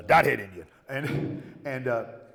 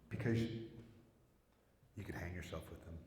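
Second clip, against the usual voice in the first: slow, much softer nurse's answer; flat, slow and quiet, stating the obvious; deadpan nurse; 6 semitones lower, 14 dB quieter, slower than usual. Retold or imitated. Nurse voiced in story.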